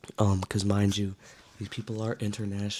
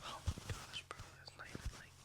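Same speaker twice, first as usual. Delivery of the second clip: whispering; 18 dB quieter than usual. Real, not acted. Whispering at the sky view.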